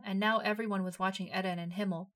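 usual speaking voice

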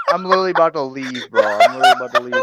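fast, high, loud while laughing. Real, not acted. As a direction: blurt it out mid-laugh. Cracking up, threatening to leave.

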